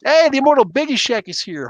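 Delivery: high, loud delighted welcome; light up to greet an arriving fan; excited greeting. Real, not acted. Streamer greeting a chat regular, excited.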